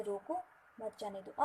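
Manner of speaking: रोको on its own, short break, then मत जाने दो; pause after रोको. Shown as a demonstration. Teacher showing the effect of a comma, pause after रोको. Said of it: "simple से comma की वजह से".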